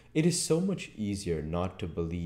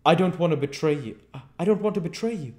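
high, loud, hurried pleading; terrified; 12 semitones higher, 10 dB louder, much faster than usual. A narrator's reading. Marvel recoils from the invisible man's touch, terrified. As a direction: blurt it out in panic. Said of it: "gave a yelp of terror at the touch".

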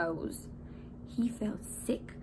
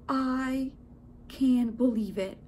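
ordinary delivery, nothing marked

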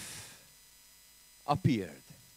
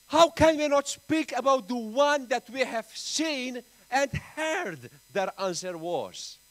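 loud, fervent preaching, higher and louder; preaching at full force; 8 semitones higher, 10 dB louder than usual. Acted. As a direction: drive the point home from the pulpit. Opening of the sermon.

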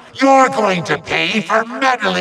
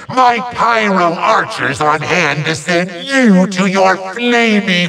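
ordinary talk

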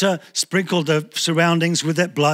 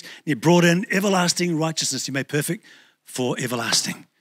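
ordinary delivery

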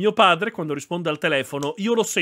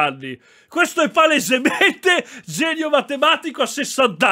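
high, loud incredulous outburst with laughter breaking in; amused disbelief; 10 semitones higher, 4 dB louder than usual. Real, not acted. Streamer reacts to himself at sixty, high laughing disbelief. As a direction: exclaim in real hilarity, pitch way up.

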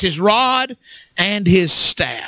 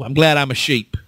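ordinary talk

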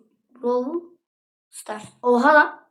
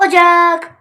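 loud, high OCAK; sudden loud burst on "ocak"; playful shout; 6 semitones higher, 8 dB louder than usual. Pretend. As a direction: call out "ocak" high and drawn out. Same word repeated, then shouted.

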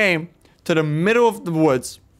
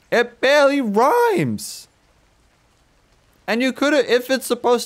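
exasperated; 9 semitones higher than usual. Real, not acted. Mocking the poem.

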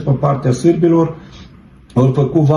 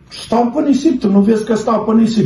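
pitched up in disbelief, calling out; astonished retort; 7 semitones higher than usual. Retold or imitated. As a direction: voice jumps high, insisting he stands on sand. (retort uimit, pe un ton mult mai înalt)

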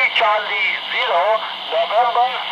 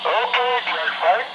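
ordinary talk

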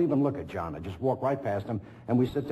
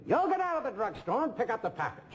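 much higher, copied speech pattern; catching the actor's voice; 12 semitones higher than usual. Retold or imitated. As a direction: slip into the other actor's high rhythm. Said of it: "I'm trying to imitate them".

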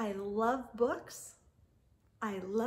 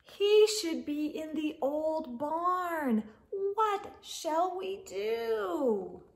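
higher, louder read-aloud, slow on the question; sing-song the refrain for little ones; storybook refrain; 7 semitones higher, 6 dB louder, slower than usual. A narrator's reading. Reader asking the children what to do, sing-song.

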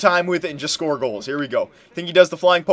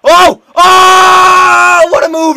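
high, loud cry of frustration; long, high wail of exasperation; frustrated shouting; 13 semitones higher, 11 dB louder, slower than usual. Real, not acted. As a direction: yell out as the opponent dangles past you. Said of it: "Now I'm getting frustrated".

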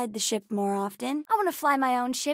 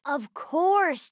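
ordinary talk

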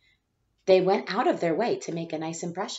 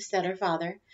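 plain reading voice

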